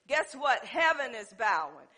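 ordinary delivery

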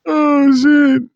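high-pitched, laughing exclamation. Retold or imitated. Reacting to cousin's workout tale, laughing. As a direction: crack up on the words.

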